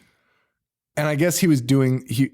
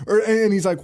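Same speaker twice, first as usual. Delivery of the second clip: normal talking voice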